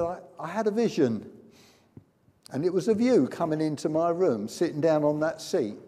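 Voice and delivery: high, insistent retelling; excited testimony. Retold or imitated. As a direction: imitate someone eagerly recounting a vision. Healed woman telling her vision.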